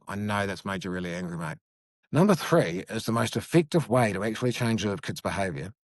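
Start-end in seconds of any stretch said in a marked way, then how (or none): 0.1-1.6 gentle and low, softer than his usual talk; soft validating. Shown as a demonstration. Dad soothes an angry child: soft, low validation. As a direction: kneel down and acknowledge the kid's anger quietly. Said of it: "validate the emotion".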